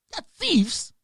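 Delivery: high, indignant outburst; angry accusation. Real, not acted. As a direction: hurl it as a real accusation. Accusing the leaders.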